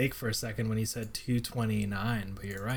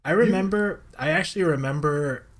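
excited recall; 6 semitones higher, 7 dB louder than usual. Real, not acted. Excited sudden memory.

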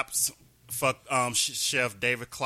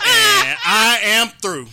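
louder, slow, laughing through it; laughing sign-off; 8 semitones higher, 12 dB louder, slower than usual. Real, not acted. Closing his minute rant, laughing and loud. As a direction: finish the rant cracking up.

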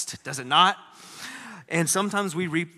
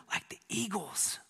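whisper; 3 semitones higher, 9 dB quieter than usual. Acted. Hushed ending of psalm.

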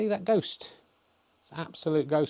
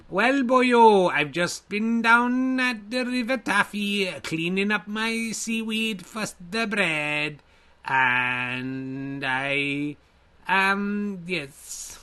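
put-on higher, slow singsong Welsh accent; 7 semitones higher, much slower than usual. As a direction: lay on a comic Welsh lilt, boyo. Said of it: "Can you do Welsh Me?".

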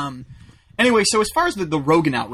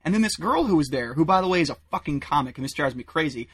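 usual speaking voice

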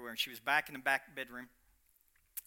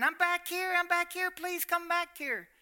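high call, mock scream for help; 13 semitones higher, 4 dB louder than usual. Mom on the floor calling out for help, mild mock scream. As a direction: call out from the back room. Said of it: "she started screaming".

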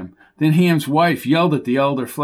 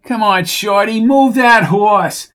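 loud and slow, higher; loud urging; 7 semitones higher, 6 dB louder, slower than usual. A narrator's reading. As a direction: drag out the shout at the jockey. Urging the jockey.

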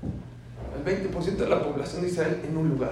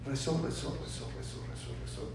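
softer and softer until whispered; fading to a whisper; 9 dB quieter than usual. Acted. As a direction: let the praying die away into breath. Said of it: "repetition fading to a whisper".

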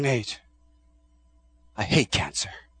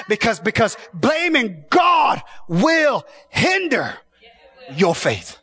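high, slow shouted preaching; preaching at full force; 8 semitones higher, slower than usual. Acted. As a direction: stretch it out and shout.

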